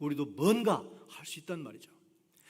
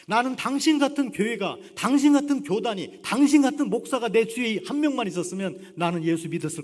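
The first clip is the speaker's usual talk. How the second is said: loud outburst; 5 semitones higher, 9 dB louder than usual. Retold or imitated. Harry's emotional outburst.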